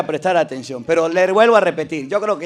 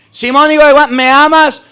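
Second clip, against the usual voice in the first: higher and louder, pressing on hijo and amas; emphatic quote; 5 semitones higher, 4 dB louder than usual. Shown as a demonstration. Preacher proclaiming Jesus's question for the laity, louder. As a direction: proclaim the question to the whole crowd. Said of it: "Ahora, con los laicos".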